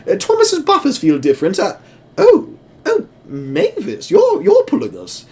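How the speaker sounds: very high, fluttery and surprised; prim carriages twittering in alarm; fussy old coaches. Acted. Coaches realizing Mavis is pulling them, very high and fussy. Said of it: "both the coaches are just like".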